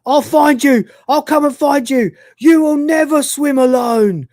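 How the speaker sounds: high, loud mock scream. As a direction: yell it out like a hammed-up desperate pursuer. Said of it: "he just keeps screaming".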